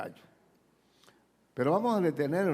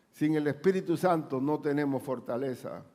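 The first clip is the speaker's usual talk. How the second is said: ordinary talk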